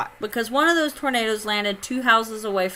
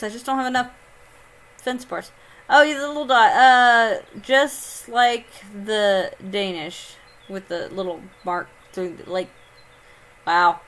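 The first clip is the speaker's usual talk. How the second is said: ordinary talk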